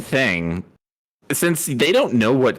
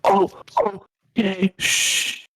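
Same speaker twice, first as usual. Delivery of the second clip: hushed dim character; 9 semitones higher, slower than usual. Acted.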